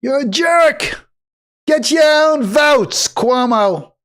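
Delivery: clumsy Brooklyn accent attempt; Sliwa Brooklyn accent. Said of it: "I can't do a Sliwa accent".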